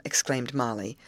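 ordinary delivery

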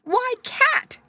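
high, loud shocked exclamation. A narrator's reading. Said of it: "she was shocked".

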